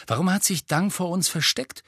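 plaintive, a touch let down; disappointed. A narrator's reading. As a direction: ask with mild disappointment. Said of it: "fragte Ron enttäuscht".

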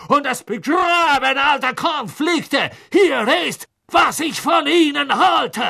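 high shouted raging tirade; furious rant. A narrator's reading. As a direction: rage and accuse at full volume. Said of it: "rasend vor Zorn".

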